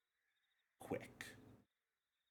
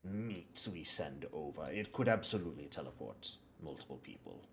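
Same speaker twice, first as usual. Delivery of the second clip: lightly voiced game character, explaining in halting, measured phrases; measured businesslike supplier; 5 semitones lower, 7 dB quieter than usual. Game master as supplier explains the binding to visitors, lightly voiced and measured. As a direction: explain the craft deliberately, with pauses to weigh it.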